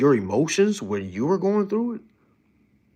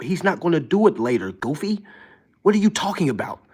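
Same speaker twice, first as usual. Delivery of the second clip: normal talking voice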